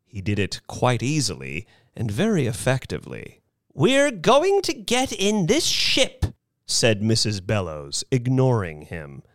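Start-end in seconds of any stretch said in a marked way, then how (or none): 3.7-6.2 put-on high, resolute elderly voice; determined old lady. A narrator's reading. Missus Bellows ignoring the crying man, determined. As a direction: announce it high, brooking no argument.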